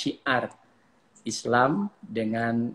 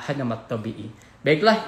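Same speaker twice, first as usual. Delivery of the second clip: ordinary delivery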